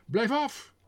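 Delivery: high, loud shout; second Frenchman shouting at the first, high and loud. A narrator's reading. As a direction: yell him off the gun. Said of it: "schreeuwde de ander".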